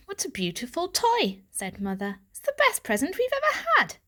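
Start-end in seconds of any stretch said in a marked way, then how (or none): 0.1-1.4 higher, admiring tone; mother delighted by the toy. Acted. 2.3-4.0 very high cooing cousin voice; cooed. Acted. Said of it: "cooed Jasmine".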